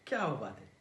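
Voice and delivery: soft, low mock admiration; sarcastic praise. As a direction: purr it with quiet sarcasm. (उपरोधिक, हळू कौतुक)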